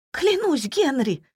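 high, urgent wifely oath; desperate pleading. A narrator's reading. Wife swears innocence to her husband, desperate pleading.

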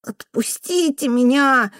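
high, slow whine; whimpering boy. A narrator's reading. Boy trying to tear the old woman's hand away, whimpering. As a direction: plead in a drawn-out child's whimper. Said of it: "хныкал пацан".

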